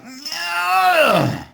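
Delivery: high, loud imitated groan that falls away; mock wail from behind a door; loud muffled groan. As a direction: let out a long anguished "ugh". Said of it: "they'd hear something like".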